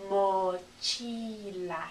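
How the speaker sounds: very slow, drawn-out "Mochila"; slow spelling prompt. Shown as a demonstration. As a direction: stretch "Mochila" so learners can spell it. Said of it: "how you would spell it".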